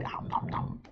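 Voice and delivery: soft unvoiced chomping sound; whispered nom nom. A narrator's reading. Narrator whispering eating noises for the toothy bite.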